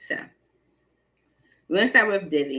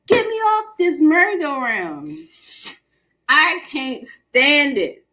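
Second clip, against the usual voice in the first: higher, slow, fed-up plea; exasperated plea; 7 semitones higher, 6 dB louder, slower than usual. Retold or imitated. To the room, pleading to escape the stuck energy, slow and exasperated. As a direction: beg to get off the ride, drawn out and exasperated. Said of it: "you gotta be like".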